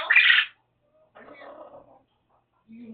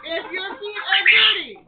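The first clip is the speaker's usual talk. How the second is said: laughing warning; 9 semitones higher, 14 dB louder than usual. Real, not acted. Scolding bird, repeating rule.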